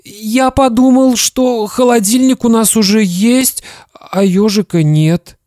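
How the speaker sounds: put-on high, thin scared child voice; frightened boy. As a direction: explain fearfully to an angry dad. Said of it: "испуганно сказал Славик".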